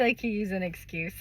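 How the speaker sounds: ordinary talk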